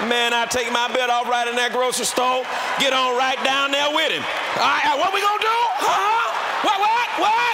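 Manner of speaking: loud high shouting; fired up. Retold or imitated. Preacher acting out disciplining a child loudly. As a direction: bark at the kid in full force.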